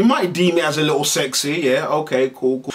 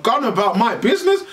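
ordinary talk, nothing marked

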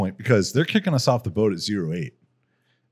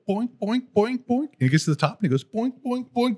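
high, bouncy cartoon boinks; cartoon boink noises; 12 semitones higher than usual. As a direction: mimic the hop of a spring. Imitating amputee hopping up stairs.

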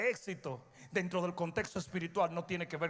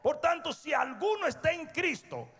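raised, higher fiery preaching; 6 semitones higher, 3 dB louder, slower than usual. Acted. Preacher driving home his point to church, fiery. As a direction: build the point to a climax.

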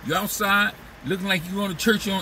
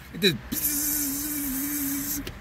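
buzzing fly; 9 semitones higher, 7 dB quieter, much slower than usual. Retold or imitated. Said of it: "string around a fly".

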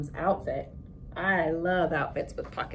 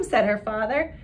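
put-on expressive read-aloud for children; story narration; 4 semitones higher, 5 dB louder than usual. A narrator's reading. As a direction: read the picture book to the class with voices. Reads picture book aloud to class.

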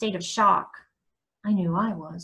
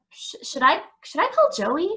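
higher, stammering, rising question; hesitant question; 7 semitones higher than usual. A narrator's reading. Son asks his mom about calling Joey, hesitant. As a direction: stumble into it, unsure, pitch climbing.

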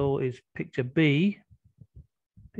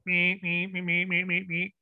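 teasing robot noises on one note; robot imitation; 3 dB quieter than usual. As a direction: mimic a robot's beeping, a little soft. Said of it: "You sound like a robot".

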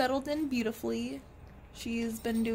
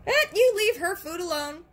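laughing scold; 7 semitones higher, 6 dB louder, faster than usual. Real, not acted.

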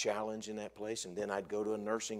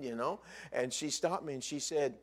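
usual speaking voice

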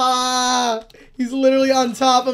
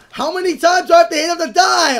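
fast, loud frustrated yell; exasperated shout; 6 semitones higher, 6 dB louder, faster than usual. Real, not acted. Gamer fighting an enemy that won't die, frustrated yell. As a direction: vent at the mob that won't die.

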